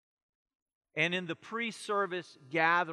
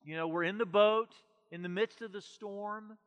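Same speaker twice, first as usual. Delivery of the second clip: normal talking voice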